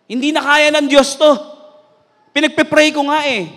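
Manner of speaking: high, loud acted complaint; exasperated doubt. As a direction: protest like a frustrated believer. Acts out a doubting believer's complaint.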